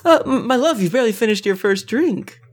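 high, laughing party lady. Acted. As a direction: tease him with a giggle. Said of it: "So she's gonna be like".

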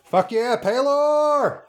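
cheer. Real, not acted. Cheering the sun god.

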